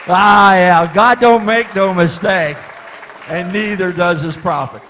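loud, high, slow pulpit declaration; preaching at full force. Acted. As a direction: proclaim it to the congregation. Preaching climax after story.

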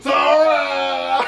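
high, excited name call; celebratory shout. Real, not acted.

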